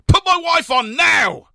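slow, high, loud shouted order; furious demand. A narrator's reading. Manager shouting at the maid on the phone, slow and furious. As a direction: bark each word down the line.

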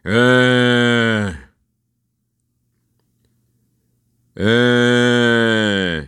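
long, drawn-out droning groan; imitated moaning. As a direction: mimic a big man moaning to himself. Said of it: "he just moaning to himself".

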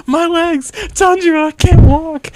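hammed-up helpless whine; whiny Zenitsu. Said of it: "He's like".

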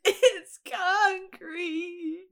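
very high, drawn-out whimper; mock wail. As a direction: pretend to cry, dragging each syllable. Wailed repeat of cast's protest.

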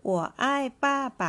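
slow model sentence. Shown as a demonstration. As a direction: stretch out 我爱爸爸 as a teaching model. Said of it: "ไปดูตัวอย่างประโยคกันนะคะ".

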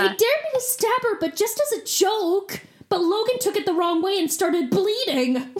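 put-on a clueless teen explaining a murder; teen girl suspect. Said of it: "every teen girl murder suspect from Law and Order".

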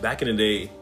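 usual speaking voice

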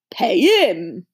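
gruff half-asleep grunt. A narrator's reading. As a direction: grunt it into a pillow. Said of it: "Hagrid grunted into the sofa".

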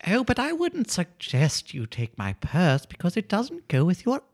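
put-on high, sweet old lady voice. Old lady happily answering the burglar, high sweet voice. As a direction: play a doting old lady.

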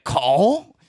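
loud, high, baffled "Croissant?"; the driver can't believe his ears; mock baffled driver asking "Croissant?"; incredulous croissant. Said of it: "He was like".

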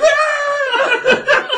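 very high loud shriek; laughing fake scream; mock scream. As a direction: shriek like a startled guest. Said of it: "I'm like".

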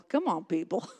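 usual speaking voice

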